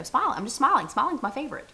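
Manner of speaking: high, cheery elf voice; bubbly, gushing Elf impression; Buddy the Elf. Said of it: "he's like, you know".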